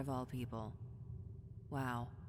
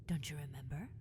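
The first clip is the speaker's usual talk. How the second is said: usual speaking voice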